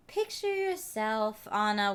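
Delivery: lightly put-on guided meditation voice; meditation app voice. Meditation app telling the listener what to picture, mock-soothing. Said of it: "an app where it's like".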